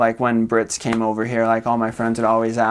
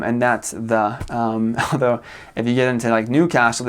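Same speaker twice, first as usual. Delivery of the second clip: ordinary delivery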